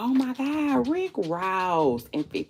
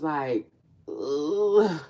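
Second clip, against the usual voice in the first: mock cry of frustration; frustrated groan. Real, not acted. Groaning to viewers about the hat, frustrated. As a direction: groan in upset.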